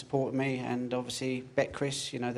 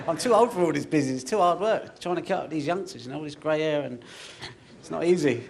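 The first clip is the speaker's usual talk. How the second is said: laughing; 5 semitones higher, 6 dB louder than usual. Real, not acted. Joking about being too old.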